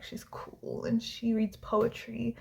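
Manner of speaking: soft, slow, detached drawl; cool and unbothered, no expression; nonchalant. Retold or imitated. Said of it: "has no expression".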